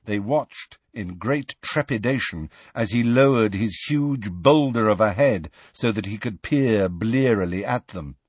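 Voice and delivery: ordinary delivery, nothing marked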